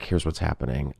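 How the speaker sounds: low, soft confiding tone; quiet and low. Retold or imitated. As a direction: drop the voice, level with her. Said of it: "I'm like".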